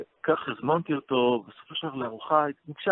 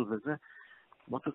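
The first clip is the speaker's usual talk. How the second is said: usual speaking voice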